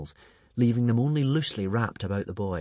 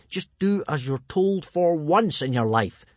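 raised, drawn-out exasperation; exasperated yell; 5 semitones higher, 3 dB louder, slower than usual. A narrator's reading. Hiccup ordering his disobedient dragon Toothless, exasperated mild yell. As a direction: yell it slowly, stressing each word. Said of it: "yelled Hiccup".

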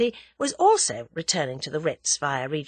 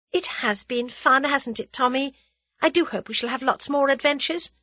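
high, hopeful chatter; eager heroine, pitched well up; spirited young heroine; 7 semitones higher than usual. A narrator's reading. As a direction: enthuse in a raised heroine's voice. Voices heroine enthusing to Tommy.

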